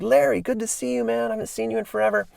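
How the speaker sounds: a touch of a happy reunion greeting; mock excited reply. [name] greets his old friend Larry at the park, mildly excited and high.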